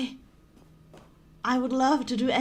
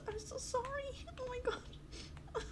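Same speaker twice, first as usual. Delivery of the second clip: very soft, high, tearful whimper; 8 semitones higher, 14 dB quieter than usual. Real, not acted. Player sorry for hurting the narrator, tearful. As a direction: barely get the apology out. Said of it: "I'm about to cry".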